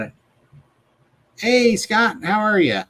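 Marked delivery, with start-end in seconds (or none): none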